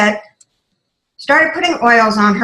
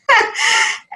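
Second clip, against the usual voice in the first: high breathy burst of giggles; imitating a contagious laugh; 13 semitones higher than usual. Retelling her friend's laugh to the audience, high giggling burst. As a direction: mimic your friend's infectious laugh. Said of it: "if any of you have ever heard Brianne laugh".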